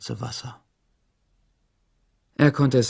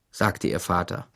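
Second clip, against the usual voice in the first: ordinary delivery, nothing marked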